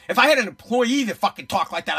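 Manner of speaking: normal talking voice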